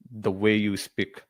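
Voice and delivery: ordinary talk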